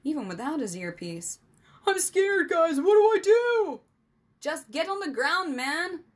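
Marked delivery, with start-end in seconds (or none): 1.8-3.8 very high, loud, terrified wail; frantic, full-out panicked cry; scared screaming. A narrator's reading. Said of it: "could hear his friend's voice from across the yard". 4.4-6.0 high, louder urgent call; urgent raised shout to a friend. A narrator's reading. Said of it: "Rennie called out".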